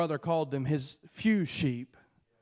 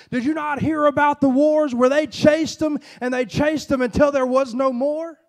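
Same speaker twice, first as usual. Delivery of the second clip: loud, pitched-up, driving sermon cadence; full-force preaching; 6 semitones higher, 7 dB louder than usual. Acted. Preacher pressing his congregation with rising, loud fervor. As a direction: build the crowd up with each question.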